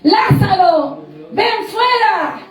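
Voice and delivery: slow, drawn-out summons; calling out. Acted. Preacher voicing Jesus ordering Lazarus out of the tomb, calling out. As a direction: call it out with stretched words. Acted command raising Lazarus.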